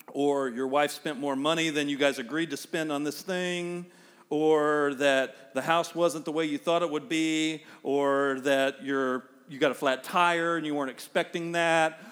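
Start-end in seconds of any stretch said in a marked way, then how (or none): none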